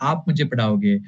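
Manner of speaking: normal talking voice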